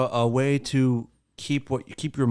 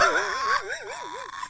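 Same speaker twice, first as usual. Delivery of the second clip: shrieking laugh; 7 dB quieter than usual. Real, not acted. Big laugh at the arm gestures.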